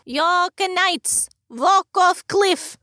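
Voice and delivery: drawn-out, high-pitched mimicry; impersonation. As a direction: stretch each word out high. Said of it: "impersonates Dami".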